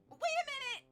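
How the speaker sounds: high, loud cry of protest; alarmed call. Acted. Teammate objects as pair leaves.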